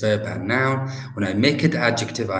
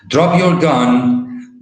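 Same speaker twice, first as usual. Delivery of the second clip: louder, higher, drawn-out police order; police command; 4 semitones higher, 6 dB louder, much slower than usual. Acted. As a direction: order an armed suspect to disarm, slowly and loudly. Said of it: "so they tell him".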